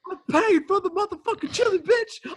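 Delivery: hammed-up high screaming rant; mock yelling Curtis. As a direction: shriek it like a furious drunk. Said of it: "he goes".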